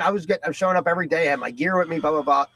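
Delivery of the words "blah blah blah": ordinary talk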